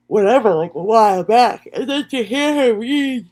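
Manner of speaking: real yawn stretching the words, high and loud. Real, not acted. Streamer recounting the chat drama to viewers, yawning mid-sentence. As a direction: talk right through a big yawn. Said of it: "I'm sorry, I'm yawning".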